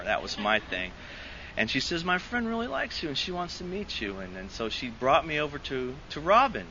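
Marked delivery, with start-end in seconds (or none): 2.0-4.2 higher, softer, lightly voiced girl; imitated matchmaking girl. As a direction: relay a friend's crush, high and soft. Repeats the girl's matchmaking message.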